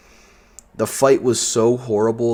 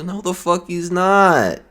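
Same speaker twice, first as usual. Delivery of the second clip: high, slow indignant outburst; outraged; 10 semitones higher, 4 dB louder, slower than usual. Real, not acted. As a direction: drag out the denial in disbelief. Ranting against fans.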